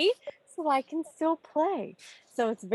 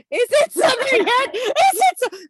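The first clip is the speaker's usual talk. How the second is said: high, loud pleading wail; mock wail; 12 semitones higher, 10 dB louder than usual. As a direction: wail it like a kid desperate for vacation. Friday joke, longing for summer.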